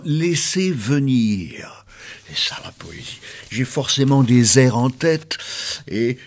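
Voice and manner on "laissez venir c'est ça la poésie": drawn out, dropping to a whisper; breathy relaxation coaching. Pretend. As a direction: let it out slowly, ending whispered.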